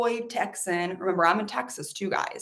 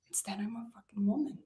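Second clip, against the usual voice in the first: whisper; 11 dB quieter, faster than usual. Real, not acted. Bitter realization, hushed aside.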